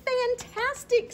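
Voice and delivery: high, loud, drawn-out fantastic; mock amazement. Princess cheering on dragon's fire, exaggerated delight. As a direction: gush over him to egg him on.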